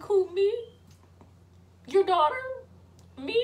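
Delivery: high, loud questions with pauses; mock incredulous, one short question at a time; disbelieving. Daughter stunned at mom's eviction, disbelieving.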